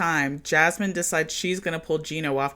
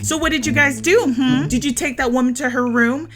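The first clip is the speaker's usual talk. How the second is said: higher, louder accusing interrogation; crunk accusation; 8 semitones higher, 6 dB louder than usual. Retold or imitated. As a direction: grill him about the woman, heated. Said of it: "Stacy gets crunk as hell again".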